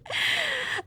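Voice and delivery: high, delighted giggle; high gloating laugh; gleeful giggle. Acted. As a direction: giggle at your own success. Delighted that the trick worked.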